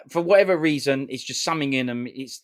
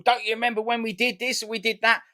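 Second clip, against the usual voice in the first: much higher, louder mock scolding dad; dad telling him off; 10 semitones higher, 4 dB louder than usual. Son imitating his dad scolding him for forgetting Newcastle, mock exasperated. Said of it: "my dad will go".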